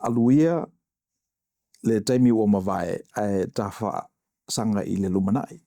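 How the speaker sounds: saying the Samoan proverb with full Samoan sounds; spoken Samoan. Shown as a demonstration. Showing a friend how Samoan sounds, spoken in Samoan. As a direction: demonstrate how the language sounds, Samoan vowels and all. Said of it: "and it sounds like this".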